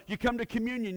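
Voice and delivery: ordinary talk, nothing marked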